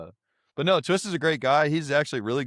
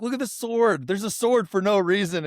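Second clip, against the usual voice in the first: higher-pitched mock disbelief; imitating an onlooker; 8 semitones higher than usual. Someone spots a sword in the table, high imitation. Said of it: "somebody was like".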